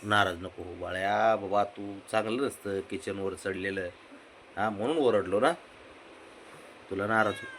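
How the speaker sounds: soft, low, gentle coaxing; tender soothing. Real, not acted. Owner soothing a pet hurt by scolding, soft tender coaxing.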